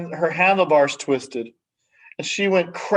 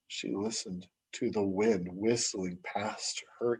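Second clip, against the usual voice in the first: softer, breathless whisper like the wind; whispered narration; 6 semitones lower, 14 dB quieter than usual. A narrator's reading. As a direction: let the line dissolve into a whisper.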